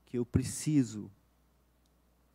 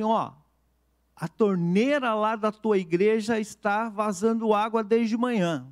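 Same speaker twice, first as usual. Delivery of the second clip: lightly voiced porter, raised pitch; higher, projected retold call; 7 semitones higher, 4 dB louder than usual. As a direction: call down to a passerby, a touch insistent. Porter calls down from high window.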